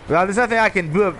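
normal talking voice

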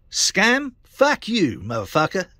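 high put-on voice snapping back; mock scammer retort. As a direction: play the crook's indignant reply.

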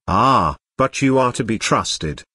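ordinary delivery, nothing marked

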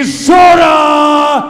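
ordinary delivery

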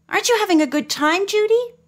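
anxiously checking on her friend; a little worried. A narrator's reading. Marjorie worried asking Judy, quick and high-pitched. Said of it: "a little worried".

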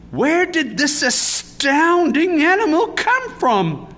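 slow, high, loud amazement; astounded, swooping question; astonished cry. A narrator's reading. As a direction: cry out, stretching the big word in wonder. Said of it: "cried Miss Sievers".

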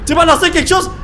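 high loud panicked shouts; panicked yelling. Real, not acted. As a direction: scream for it to stop. Spider attacks player. (cris de panique)

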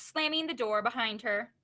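ordinary delivery

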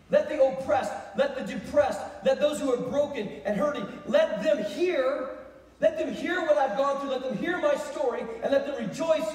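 loud, high, insistent proclamation; rousing preaching. Acted. David calling the broken and hurting to hear, preached at full force. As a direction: build a drumbeat of ringing appeals.

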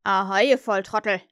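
loud, rough captain's hail; Hook impression. Peter tricks the pirates, loud imitation of Hook. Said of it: "ahmte er die Stimme von Hook nach".

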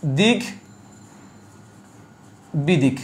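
দিক, long pause, then বিদিক; split pronunciation. Shown as a demonstration. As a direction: break দিক বিদিক in two, sounding both ক and ব. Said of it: "এটা হবে কি?". (দিক... বিদিক, থেমে ভেঙে উচ্চারণ)

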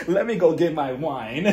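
talking through a laugh; amused, still cracking up. Real, not acted. Still laughing after the joke.

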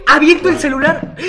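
excitedly reliving the moment of checking the channel; excited reenactment. Retold or imitated. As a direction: relive the thrill of checking the phone. Reenacting checking subscribers, thrilled.

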